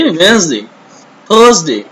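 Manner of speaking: ordinary talk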